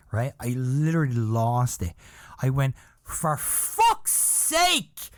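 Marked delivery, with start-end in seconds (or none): 3.0-5.1 high, slow, drawn-out mock scream; retold scream. Boy in the cinema screaming at the old man, high and drawn out. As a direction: stretch out the curse in a high yell. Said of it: "I literally screamed it".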